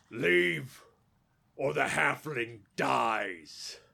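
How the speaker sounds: loud goblin ultimatum, drawn out at the end; shouted threat. Acted. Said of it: "He shouts to you guys".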